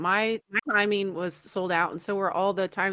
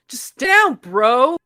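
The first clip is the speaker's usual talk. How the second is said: loud, raised-pitch call across a theater; mock heckle; 4 semitones higher, 11 dB louder, slower than usual. Another guy goes at the man, loud heckle. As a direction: yell it like a fed-up audience member. Quoting another annoyed moviegoer.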